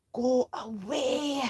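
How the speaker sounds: hissed go away. A narrator's reading. Dad hissing at his son during call. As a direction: shoo him off under your breath. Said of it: "hissed Dad".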